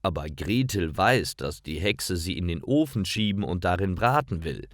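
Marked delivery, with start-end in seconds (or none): none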